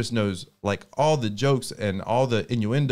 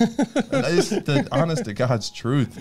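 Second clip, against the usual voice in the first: slow, higher, every word weighed; earnest insistence; 7 semitones higher, much slower than usual. Real, not acted. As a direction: insist it's the honest truth, drawn out. Insisting on sincere praise.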